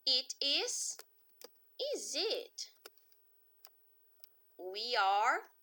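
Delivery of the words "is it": "is he?" rising high as a question; high inverted question; question tune. Shown as a demonstration. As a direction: lift "he" up into a question. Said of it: "vamos perguntar".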